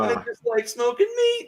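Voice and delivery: high, far stoner voice; Shaggy impression. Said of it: "[name] can do a very excellent stoner impression".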